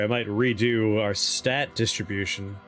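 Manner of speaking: ordinary talk, nothing marked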